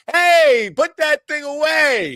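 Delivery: loud high yell, then slow; shouting. Retold or imitated. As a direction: bark the order out loud. Retold shout at Jackie.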